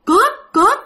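high, loud cry of alarm; shouting thief. Retold or imitated. In the dark house, someone cries out an alarm: high, loud yell. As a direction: yell it out in a panic at night. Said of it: "Mà kêu".

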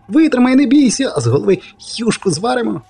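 very high, then rushing ahead quickly; high eager urging. Acted. Poet encouraging Geralt to hold on, high and eager. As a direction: spur him on, already tasting the soup.

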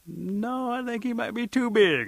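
high, loud drawn-out objection; high-pitched, picky girl's protest; picky girl refusing. Acted. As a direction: turn him down in a high, rising voice. Picky girl rejects big warrior.

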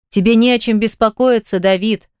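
usual speaking voice

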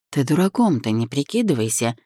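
usual speaking voice

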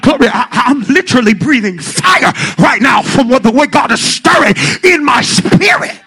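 loud, climbing sermon shout; preaching at full force. Real, not acted. Preacher to congregation, loud impassioned shouting.

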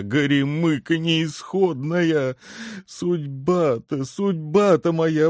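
high-pitched, self-pitying shoemaker's lament; fervent lament. A narrator's reading. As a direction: bewail your fate, drawing out the cries, voice climbing. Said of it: "с жаром продолжал башмачник". (горестное причитание с жаром)

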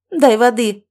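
loud, sudden bark; barked order. A narrator's reading. Mother orders son to fetch water, barked order. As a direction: snap out the command loudly. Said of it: "рявкнула она".

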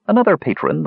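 usual speaking voice

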